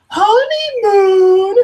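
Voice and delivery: high, loud, very slow teasing; drawn-out kid voice. Retold or imitated. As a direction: stretch it out gleefully. Kid's punchline.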